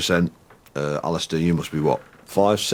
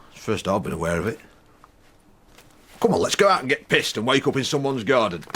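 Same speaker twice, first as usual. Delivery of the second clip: playing himself and his anger; reading his therapy script aloud; two-voice dialogue; 7 semitones higher than usual. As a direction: act both sides of a quarrel. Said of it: "your conversation with your anger".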